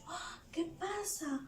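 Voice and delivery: hushed, high-pitched wondering; acted puzzled overhearing; surprised listener. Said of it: "Yo escucho".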